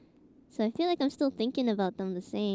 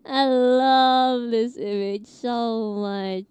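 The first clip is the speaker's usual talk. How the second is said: crying with laughter; laughing; 2 semitones higher, 6 dB louder, much slower than usual. Real, not acted. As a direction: squeal it through laughter. Laughing at a funny image.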